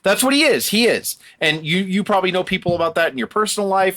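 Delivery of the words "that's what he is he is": real anger, pitched up high; pissed off. Real, not acted. Podcaster ranting about a bully wrestler, pissed off. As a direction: insist on it, worked up and higher. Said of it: "Sorry, I'm getting pissed off".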